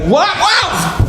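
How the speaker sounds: high yelp of surprise; acted startled cry. Father as clothes bags are thrown down, startled yelp.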